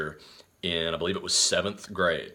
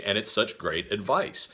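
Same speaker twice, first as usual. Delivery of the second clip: ordinary talk, nothing marked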